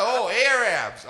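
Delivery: high, slow Southern drawl; Texan sergeant. Retold or imitated. Sergeant finally getting it, slow Southern drawl. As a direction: drawl it out as it dawns on him. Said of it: "He's like".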